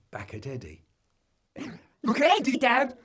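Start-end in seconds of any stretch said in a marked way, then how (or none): 2.0-3.0 put-on higher, louder tattling kid; excited child. A narrator's reading. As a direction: tell on a little brother eagerly. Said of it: "says Joe".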